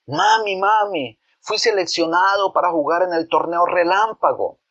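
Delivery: put-on high, bright-eyed boy voice bursting with news; excited little boy. Boy bursts in to tell his mother he was picked, excited. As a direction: run in calling mami, thrilled to be chosen.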